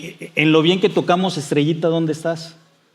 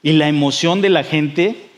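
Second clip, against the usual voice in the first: ordinary talk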